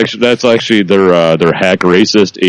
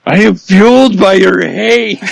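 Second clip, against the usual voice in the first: mock villain; 12 semitones higher than usual.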